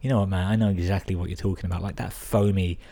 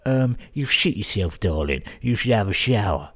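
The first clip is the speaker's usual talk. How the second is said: put-on character voice, pitched up; Jamie; 2 semitones higher than usual. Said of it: "I like to imagine he's like".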